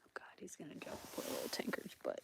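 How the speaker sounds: fast whisper under the breath; barely audible, hurried muttering; hushed aside. Real, not acted. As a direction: whisper it quickly to yourself. Aside while watching the dogs.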